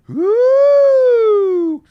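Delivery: loud high falsetto whoop. Shown as a demonstration. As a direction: let out a ringing head-voice woo. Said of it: "more resonation in the head".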